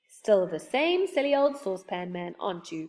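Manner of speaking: ordinary delivery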